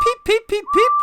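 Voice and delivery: very high, loud urgent peeps; impatient whistling. A narrator's reading. Edward whistling for the missing guard, urgent and high. Edward was getting anxious.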